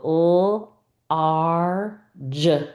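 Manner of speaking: "l, r, j" as separate drawn-out speech sounds; sounding out. Shown as a demonstration. Teacher sounds out large for a game, "l, r, j" one by one. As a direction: hold each sound of "l, r, j" alone, pausing between. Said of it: "I might go".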